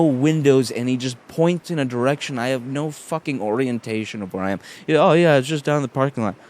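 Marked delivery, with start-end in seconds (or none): 5.0-6.3 loud, higher mock directions; mocking quote. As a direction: throw the casual directions back scornfully. Mocks the man's vague directions.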